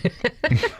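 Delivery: quick high breathy laugh. Real, not acted. Husband laughing at his wife's reply. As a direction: laugh at her fondly.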